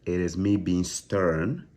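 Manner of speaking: slow, deliberate, serious; firm slow explanation; slow and stern. Real, not acted. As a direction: spell it out slowly. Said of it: "I'm being serious".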